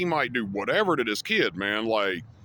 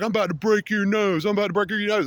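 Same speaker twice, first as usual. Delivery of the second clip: high-pitched imitation of a threatening kid; hammed-up retold threat in a higher voice; mock threat; 6 semitones higher than usual. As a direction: mimic a drunk kid's threat, pitched up. Retold drunk kid threatening him.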